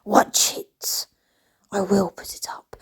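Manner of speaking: voiceless conspiratorial whisper; whispered aside. Real, not acted. Teasing viewers about her upcoming video, whispered. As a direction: lean in and whisper the promise.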